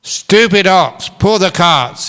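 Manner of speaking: high, loud, slow angry shout; shouted order. Acted. Farmer shouts at the ox that won't move. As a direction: yell it at the stubborn ox. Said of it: "he shouted".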